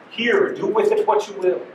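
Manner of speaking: put-on Google Maps answering in some vague foreign accent. Google Maps hands data over in a vague accent. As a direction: hand it over in a light, unplaced accent. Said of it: "I don't know why it has an accent".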